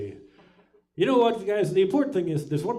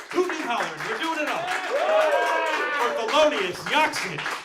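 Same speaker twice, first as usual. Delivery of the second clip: hype announcement; 12 semitones higher, 8 dB louder than usual. Real, not acted. Announcing next comic.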